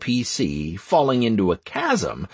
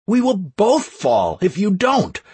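higher, louder straining plea; urgent; 9 semitones higher, 4 dB louder than usual. Acted. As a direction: push it out urgently. Hero urging woman while slipping.

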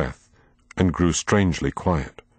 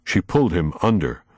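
ordinary delivery, nothing marked

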